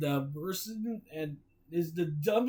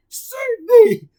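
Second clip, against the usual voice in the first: scream; 17 dB louder than usual. Acted. SpongeBob waking from nightmare.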